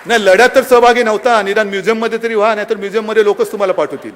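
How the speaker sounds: mocking taunt. Real, not acted. Taunting opponents at rally.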